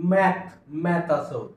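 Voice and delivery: "मॅथ" said with a short flat a as in cat, not aa; slow "मॅथ" with the a of cat; careful "मॅथ", not "माथ"; exception vowel. Shown as a demonstration. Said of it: "ए चा उच्चार आ असा होत नाही".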